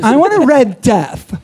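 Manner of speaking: put-on high, slow party girl order; mock a college party girl ordering. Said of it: "That was Sue in college".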